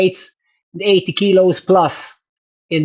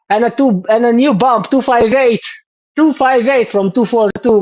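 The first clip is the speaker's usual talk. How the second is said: excited; 7 semitones higher, 3 dB louder than usual. Real, not acted. Reading out the big FTP jump.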